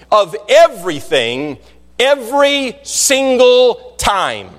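slow, drawn-out pulpit proclamation; emphatic preaching. Real, not acted. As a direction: stretch each key word out for the congregation. Preaches climactic charge to congregation.

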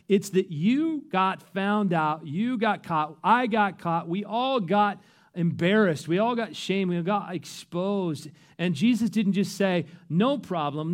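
full-force preaching. Acted. Pastor pressing the gospel on the congregation, full-force preaching. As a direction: preach it out to the whole room.

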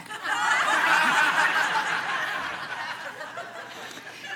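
high rising laugh at herself; real amused laugh. Real, not acted. Laughing at her own mistake.

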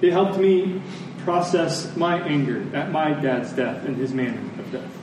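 usual speaking voice

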